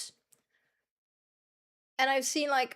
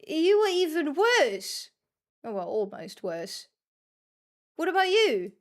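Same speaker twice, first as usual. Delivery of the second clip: mock outrage; 5 semitones higher, 4 dB louder than usual. Real, not acted. Teasing teammates mid-match.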